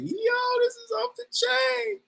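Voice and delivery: very high, drawn-out amazed exclamation; retold excitement. Retelling the party in Ghana, in high-pitched excitement. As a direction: relive being blown away, voice way up.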